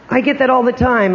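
usual speaking voice

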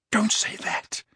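unvoiced, pleading whisper. A narrator's reading. Slughorn whispering to Harry, begging him to stop. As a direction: whisper it as a plea. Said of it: "he whispered".

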